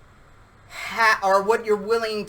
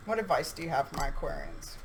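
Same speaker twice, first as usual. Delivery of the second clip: soft, lower question to Spirit; quiet question; 5 semitones lower, 9 dB quieter, faster than usual. Real, not acted. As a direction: ask it softly, almost to yourself. Asking Spirit softly.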